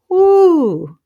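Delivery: high, loud "woo" of chills; shuddering woo. Real, not acted. Host gets chills retelling it, shuddering woo. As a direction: let out a shivery whoop. Shudders at her own creepy memory.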